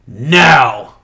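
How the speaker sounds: loud mock shout of refusal; hammed-up, loud refusal; imitated blunt refusal. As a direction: shout it as the girl's curt answer, exaggerated. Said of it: "And the girl's like".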